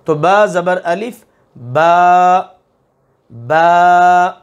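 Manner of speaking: با said thin and light with a clear open a, not rounded. Shown as a demonstration. تجوید کا استاد طالب علموں کو با باریک پڑھ کر دکھاتا ہے، صاف اور ہلکی آواز. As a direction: keep با light, never let it sound like بو. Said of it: "بو نہیں کرنا".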